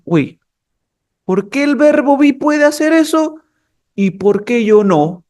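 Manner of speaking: high, indignant put-on complaint; envious verbs' protest. Other verbs envying the verb be, indignant complaint. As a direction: protest like a left-out rival. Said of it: "los demás verbos dijeron".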